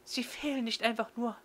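put-on high, friendly librarian girl. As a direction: play a bright young girl. Yuko voiced by narrator.

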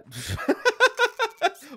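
high loud cackle; big burst of laughter. Real, not acted. Checkpoint guard bursts out laughing at a traveller's new look. Mocking a photo mismatch.

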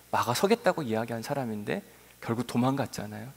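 ordinary talk

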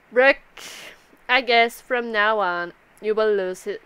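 ordinary delivery

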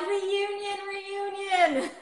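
high, excited mock cheer; mock fan squeal. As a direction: chant it like a gushing fan. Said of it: "You're like".

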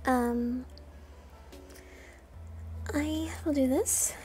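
ordinary talk

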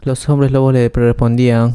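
plain reading voice

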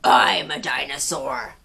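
low, menacing dinosaur voice; gnashing creature. Acted. Reader voicing gnashing slashing dinosaur to Pipkin. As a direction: growl it like a big-toothed beast. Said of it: "said the creature".

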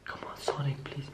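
whispered plea to the phone; tense whispered begging; pleading whisper. Real, not acted. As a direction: breathe the words, begging him to answer. Begging while call rings.

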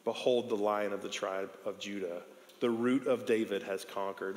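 hushed, solemn announcement; hushed reverent proclamation; gentle elder. Retold or imitated. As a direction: softly reassure him the victory is won. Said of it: "looks over to him and says".

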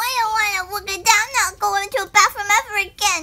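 high, loud upset whine; upset whining. Acted. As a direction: complain loudly, grossed out. Upset after bug prank.

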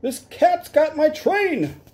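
high, slow squeaky wail; panicked engineer. Acted. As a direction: wail that the cat took your train. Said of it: "cried the engineer".

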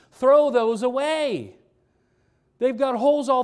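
normal talking voice

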